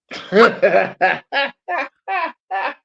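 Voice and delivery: high, long burst of laughter; real laughter. Real, not acted. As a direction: crack up in a rising-pitched run of laughs. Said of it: "That's why I'm laughing".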